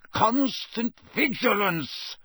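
high, slow, drawn-out roar. A narrator's reading. Teacher startles the whole class, roaring. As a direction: roar each word as a warning. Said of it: "he roared".

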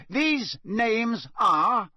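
cutting, slow official; sharp. A narrator's reading. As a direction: snap him back to it. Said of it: "said Mister Crouch sharply".

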